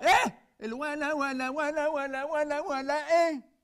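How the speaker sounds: high, loud panicked yelps; loud, high shock at bad news; shocked outburst. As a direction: stammer in comic alarm.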